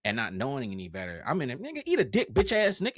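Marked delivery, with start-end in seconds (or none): 1.6-3.0 high, loud mock outburst; heated clapback. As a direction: fire back in an exaggerated loud, high voice. Retelling his old clapbacks to trolls.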